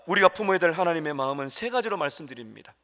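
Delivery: usual speaking voice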